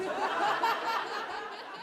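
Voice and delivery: short squeaky laugh at his own line; real laugh at his own joke; high laugh. Real, not acted. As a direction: give a high giggle. Laugh after punchline.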